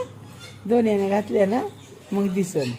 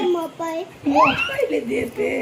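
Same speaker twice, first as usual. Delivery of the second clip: slow, stretched and higher, with a laugh in it; drawn-out singsong; 5 semitones higher, 3 dB quieter, much slower than usual. Real, not acted. Mother repeating her promise to the baby, slow stretched singsong. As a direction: pull the words out long and lilting, smiling.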